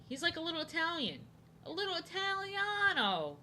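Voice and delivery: a little singsong Italian voice; mock Italian accent.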